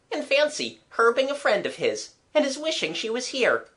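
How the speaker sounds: put-on high boyish voice, marvelling; Jimmy, marvelling boy. A narrator's reading. Boy commenting on the reunited pair, marvelling.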